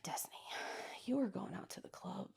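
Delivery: soft horrified whisper; whispered self-scolding. Retold or imitated. Looking at an old photo of herself, shocked whisper. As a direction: whisper it in disbelief at yourself.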